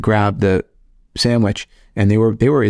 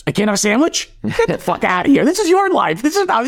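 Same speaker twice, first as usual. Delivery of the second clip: much higher, mocking older-brother voice; mock angry brother; 12 semitones higher than usual. Mocking his older brother for lecturing him. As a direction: squeak it out as the outraged brother. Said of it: "my older brother".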